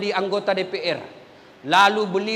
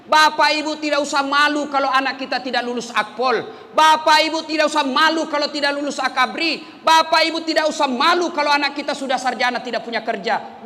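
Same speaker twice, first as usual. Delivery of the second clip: raised, urgent sermon voice with oaths hit hard; earnest preacher pleading with parents; fervent preaching; 4 semitones higher than usual. Acted. Preaching at full force.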